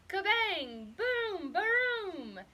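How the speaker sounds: mock thunder burst, high and loud; growling thunder. A narrator's reading. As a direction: bang out the thunder. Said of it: "The thunder growled".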